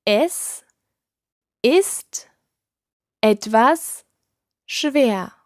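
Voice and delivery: "Es", "ist", "etwas", "schwer" each alone with pauses; feed it one word at a time to repeat; slow word by word. Shown as a demonstration. Teacher to learners, separated words for them to repeat. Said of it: "Try saying that".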